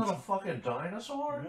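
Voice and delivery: high-pitched put-on actor voice; jokey high impression of amazement; Owen Wilson. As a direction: imitate the actor marveling, pitched well up. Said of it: "Where's Owen Wilson?".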